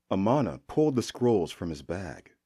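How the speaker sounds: plain reading voice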